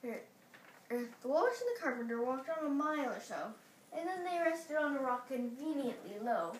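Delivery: plain reading voice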